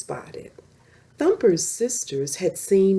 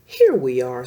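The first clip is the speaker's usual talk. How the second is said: proudly; 6 semitones lower, 5 dB louder than usual. Acted. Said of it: "Slap said proudly".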